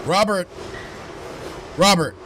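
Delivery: loud call across room. Acted. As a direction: call the name loudly. Calling out to Robert.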